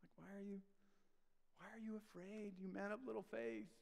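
softly unimpressed, as if rolling your eyes; nonchalant. Acted. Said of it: "rolling his eyes and just nonchalantly is like".

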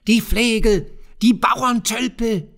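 high, loud shrieking goblin; screaming kobold. A narrator's reading. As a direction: shriek it out like a spiteful imp. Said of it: "schrie er". (kreischender Kobold, hoch und laut)

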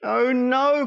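Mother Duck. Acted. Mother duck to her ducklings, worried and high. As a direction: fret like a flustered mother duck. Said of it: "quacked Mother Duck".

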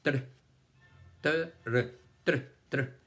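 "Tr" as a short burst with rolled r; consonant blend sound. Shown as a demonstration. Teacher showing two consonants together, "Tr" as one isolated sound. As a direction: sound "Tr" out alone, joining t and r. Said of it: "este sonido".

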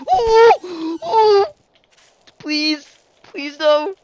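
high desperate pleading wail; anguished denial. Real, not acted. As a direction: beg for your life, voice cracking. Chased to death in game.

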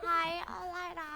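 put-on high, breathy cutesy girl voice. Woman switching to a sweet put-on voice for a man. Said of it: "ชอบมีทำเสียงว่า".

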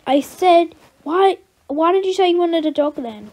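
a parent scolding the daughter over the dog; exasperated scolding. Acted. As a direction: tell her off, fed up.

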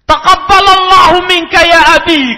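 suara anak yang tinggi dan keras; suara anak kecil. Retold or imitated. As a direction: tirukan anak kecil yang mendoakan ayahnya. Anak kecil mendoakan ayahnya. (anak kecil mendoakan bapaknya dengan suara tinggi)

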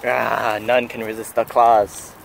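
low, slow and menacing; menacing villain voice. Pretend. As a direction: growl it like a cartoon villain. Friend voices lure menacingly.

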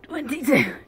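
loud strained final count; straining effort. Real, not acted. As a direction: push out the last rep with effort. Last push-up, straining.

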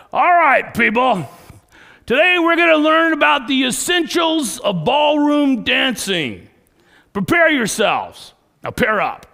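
high, booming gridiron coach shout. Retold or imitated. Gridiron coach lining up boys for ballroom dancing, shouting. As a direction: yell orders at a team across the field. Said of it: "he shouted at us like we were on the gridiron field".

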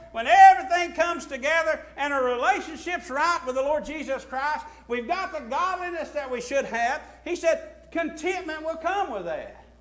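loud, high mock quote from the pulpit; fervent sermon voice; preaching at full force. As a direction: ring it out to the saints. Preacher's climactic point.

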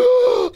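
sharp, high-pitched intake of breath; panicked gasp. Acted. Boy fleeing a ghost, high terrified gasp. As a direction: gasp in terror.

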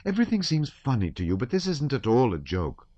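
hurt, high protest with stress on this; insist, wounded, that this is no joke; injured tone. A narrator's reading. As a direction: Natasha stung by the teasing, high and hurt. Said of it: "in an injured tone".

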